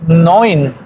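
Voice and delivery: "neun" with EU turned into OI; "neun" sounded NOYN, one gliding vowel; German pronunciation. Shown as a demonstration. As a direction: say "neun" as noin. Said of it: "la E junto con la U cambia a, por O I".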